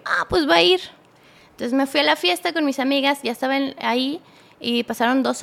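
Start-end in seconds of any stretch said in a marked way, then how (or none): 0.0-0.8 louder, higher-pitched retold realization; mock surprise. Girlfriend realizes he is letting her go, surprised. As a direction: act out a sudden surprised reaction.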